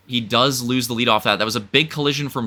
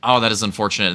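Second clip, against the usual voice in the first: ordinary talk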